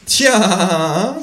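high, knowing kangaroo tja; meaningful tja. Acted. Kangaroo dodging the Vietcong question, knowing tja. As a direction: drop it like it means everything. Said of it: "sagt das Känguru vielsagend".

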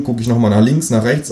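ordinary delivery

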